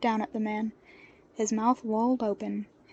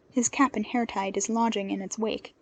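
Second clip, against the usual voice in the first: plain reading voice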